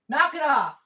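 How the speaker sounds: high firm repeat of the command. Real, not acted. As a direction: scold the dogs again, high and firm. Don't make me get my mom voice going.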